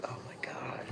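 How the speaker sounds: breathless hushed "oh my God"; awed whisper. Retold or imitated. Retelling first seeing the new Jeep, in a hushed whisper. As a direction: whisper it like you can't believe it.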